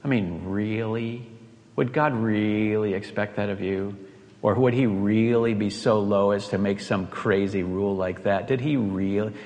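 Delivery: sly serpent voice. Acted. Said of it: "You can hear it in his question".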